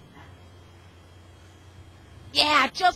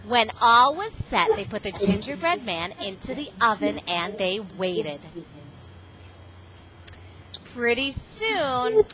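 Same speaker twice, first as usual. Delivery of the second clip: gentle storybook narration, unhurried; reading to children; 3 semitones lower than usual. Acted. Guest reader telling The Gingerbread Man to a class, storybook voice. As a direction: read it like a picture book to first graders. Reads picture book to children.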